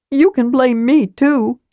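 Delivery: lightly remorseful kender. A narrator's reading. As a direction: offer it sorry and keen. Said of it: "Tass offered remorsefully".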